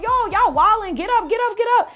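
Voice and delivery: high-pitched insistent nagging; urgent rousing. Retold or imitated. Retelling herself trying to get friends out of bed, urgent rousing.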